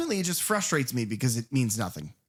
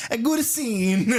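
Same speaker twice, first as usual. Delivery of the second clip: high, satisfied auteur voice; pleased director, pitched up; hammed-up Italian director approval; put-on director voice; 7 semitones higher, 3 dB louder, slower than usual. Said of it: "And he's like".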